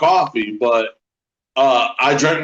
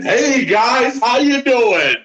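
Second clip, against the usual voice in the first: much higher, bright new-man greeting; chipper greeting; 8 semitones higher, much slower than usual. Retold or imitated. At the Speedway, greeting everyone as a new man, high and chipper. Greeting people cheerfully in store.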